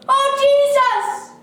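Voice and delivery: very loud, high, drawn-out call. A narrator's reading. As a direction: cry the name at full voice. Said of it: "kept on calling louder still".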